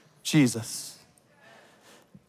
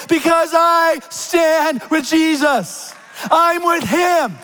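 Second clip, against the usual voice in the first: emphatic preaching; 10 semitones higher, slower than usual. Acted. Preaching climax.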